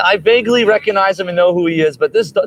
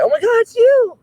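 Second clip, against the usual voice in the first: very high squealed dog voice; ecstatic dog. Acted. Dog recognizing its person, high thrilled squeal. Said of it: "It's like".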